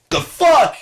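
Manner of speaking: high, loud, startled outburst; high loud yelp. Retold or imitated. Seeing the Batman guy, a high loud yelp.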